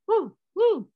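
loud, high-pitched "woo" like a pop star; high dance whoops. Acted. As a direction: whoop sharply in imitation, mid-dance. Imitates his [name] dance whoops.

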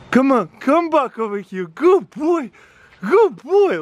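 high dog talk, rising into the praise; high-pitched pet praise; calling the dog. Real, not acted. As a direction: call the dog over, then praise it high. Calling dog back, then praising.

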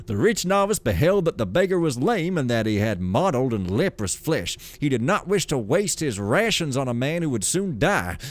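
lively, sing-song storybook reading; story narration. A narrator's reading. Gamer reading lore aloud as a tale, storytelling voice. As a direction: read it like a grand old fable.

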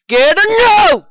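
high, loud startled yell. Real, not acted. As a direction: shout it mid-fight. Yelling during fight.

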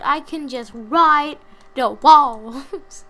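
very slow, loud, smug drawl. Pretend. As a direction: stretch every word, showing off. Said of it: "Like I've said".

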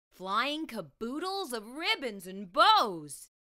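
usual speaking voice

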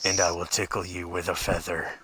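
low creepy stalker voice; heavy breathing phone whisper. Pretend. Continues creepy voice.

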